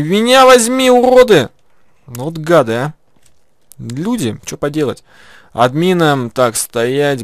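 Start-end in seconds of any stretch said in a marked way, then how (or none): 0.0-1.5 high angry yell; shouting. Real, not acted. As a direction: scream it after them, much higher. Begging teammates to pick him up.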